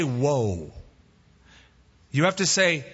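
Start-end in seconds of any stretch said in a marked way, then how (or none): 0.0-0.7 long, low falling whoa; horse command. Acted. Preacher warning the horse's buyer, drawn-out horse command. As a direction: draw the word out and let it sink. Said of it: "don't say".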